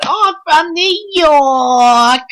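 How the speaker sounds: high, slow, exaggerated Staten Island accent; mocking her put-on Staten Island accent. Mocking Carmella's promo in a hammed-up Staten Island accent. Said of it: "fake Staten Island accent".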